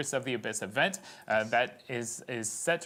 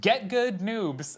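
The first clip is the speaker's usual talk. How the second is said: cocky gamer taunt, higher and louder; 8 semitones higher, 5 dB louder, much slower than usual. Pretend. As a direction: jeer it like a smug pro player. Repeating guest's taunt as message.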